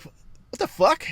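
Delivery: high bewildered outburst; startled squeal at the goats; shocked what the fuck. Retold or imitated. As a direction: blurt it out in disbelief.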